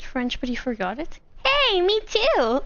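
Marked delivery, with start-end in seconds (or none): 1.4-2.7 high, slow gleeful me too; pleased to find company, higher; delighted. Real, not acted. Finding shared forgetting.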